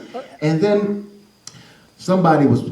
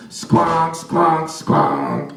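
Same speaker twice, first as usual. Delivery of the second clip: sung squonk; 2 semitones lower, much slower than usual. Acted. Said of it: "it sounded like this".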